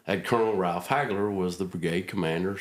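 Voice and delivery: normal talking voice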